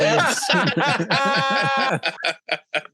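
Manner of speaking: long helpless laugh; hysterical laugh. Real, not acted. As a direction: laugh until you can't stop. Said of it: "Why are you laughing so hard".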